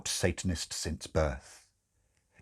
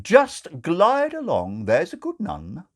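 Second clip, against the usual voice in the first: high, loud mock-patronising; 10 semitones higher, 7 dB louder, slower than usual. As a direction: shoo her away sweetly. Crowley quoted, patronising.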